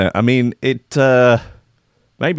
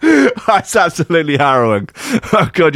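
high-pitched, cracking up; helpless with laughter at own joke; laughing through words; 7 semitones higher than usual. Real, not acted. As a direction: gasp it out between laughs. Laughing at his own story.

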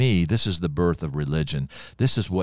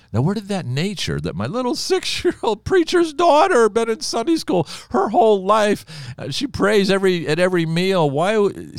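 high, loud pulpit cry; full-force preaching; 6 dB louder than usual. Real, not acted. Preacher asks congregation where sin came from, full-force preaching. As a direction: preach it out to the back pew.

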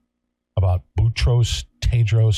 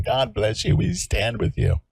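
mock Facebook well-wishers; 8 semitones higher, 4 dB quieter than usual. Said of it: "people were sitting at home on Facebook saying".

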